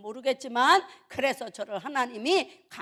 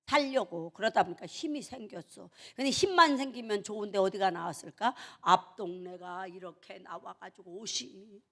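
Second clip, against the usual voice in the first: normal talking voice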